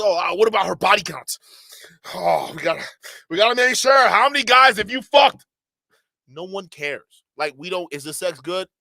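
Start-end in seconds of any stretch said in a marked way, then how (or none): 0.0-5.4 louder mocking put-on voice of an obsessed guy; hammed-up mock of a worried red pill guy. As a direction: lampoon a paranoid dude, getting louder.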